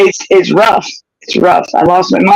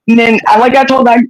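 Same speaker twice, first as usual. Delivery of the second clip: ordinary talk, nothing marked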